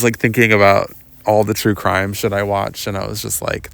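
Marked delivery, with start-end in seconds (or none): none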